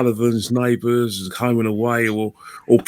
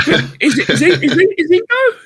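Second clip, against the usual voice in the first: laughing; 3 dB louder than usual. Real, not acted. Giggling fit over Cummins' voice.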